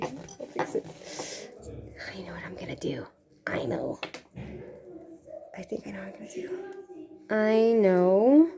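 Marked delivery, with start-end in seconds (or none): 0.0-6.5 soft unvoiced whisper to herself. Real, not acted. Vlogger whispering to herself while fixing makeup. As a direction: whisper it under your breath.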